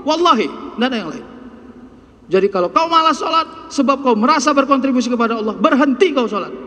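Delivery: keras dan tinggi, menegur tajam; kasar. Acted. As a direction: tegur jamaah dengan kasar. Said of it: "saya harus kasar ngomongnya". (ustadz menegur jamaah dengan kasar dan keras)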